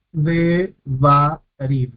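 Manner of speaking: "Devarim" muy lento, de... va... rim; separado en sílabas. Shown as a demonstration. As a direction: marca cada sílaba de "Devarim" con una pausa. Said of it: "tenemos tres sílabas". ("Devarim" dividido en tres sílabas, como profesor)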